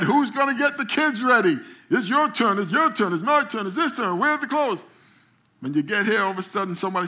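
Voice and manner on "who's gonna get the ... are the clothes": mock quarrel. Couple quarrelling before church.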